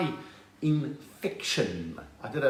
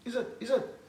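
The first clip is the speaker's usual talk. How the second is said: higher, softer repeated challenge; quiet, raised-pitch goading between rivals; mock boxer stare-down; 5 semitones higher, 8 dB quieter than usual. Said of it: "They look at each other going like".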